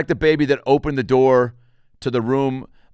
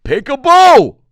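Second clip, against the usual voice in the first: huge high-pitched shout; peekaboo yell; 15 dB louder, slower than usual. Acted. Playing peekaboo at an unimpressed kid, yelled. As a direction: blast it out at a toddler.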